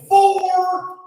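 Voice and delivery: yelled high like a golf warning; high callout. Shown as a demonstration. Comic showing the crowd their reply, high callout. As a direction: sing out the word way up high. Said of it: "and you guys go".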